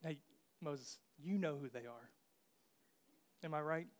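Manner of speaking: straight-faced, a hint of a plea; dead serious. Aaron pleading with Moses, deadpan serious. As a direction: keep it deadpan and earnest. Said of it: "dead serious".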